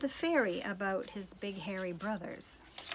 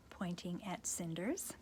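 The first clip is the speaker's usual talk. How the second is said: ordinary delivery, nothing marked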